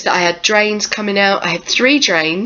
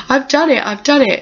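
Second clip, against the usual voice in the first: elated; 7 semitones higher than usual. Retold or imitated.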